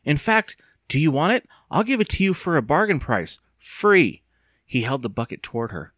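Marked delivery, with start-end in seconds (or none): 0.0-4.2 high, loud sales offer; eager. A narrator's reading. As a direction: push it on her eagerly. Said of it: "he said eagerly".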